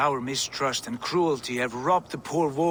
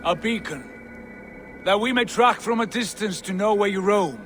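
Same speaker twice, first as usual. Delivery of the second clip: higher and louder, coaxing across the distance; raised persuasive voice; 6 semitones higher, 3 dB louder than usual. Acted. The visitor urges the wolf to wear the collar, raised coaxing voice. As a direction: call up to him, selling the offer.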